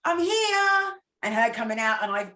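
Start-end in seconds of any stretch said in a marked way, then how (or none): 0.0-0.9 announcing arrival to grandma, called out; calling out. Retold or imitated. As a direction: call out your arrival happily.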